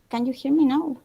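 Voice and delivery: ordinary talk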